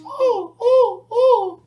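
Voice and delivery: very high, loud cartoon mouse moans; high-pitched squeals. Joking as Minnie Mouse, high-pitched squeals. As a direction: squeal it in a tiny cartoon falsetto. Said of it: "come on, high pitch".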